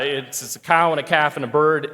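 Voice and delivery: usual speaking voice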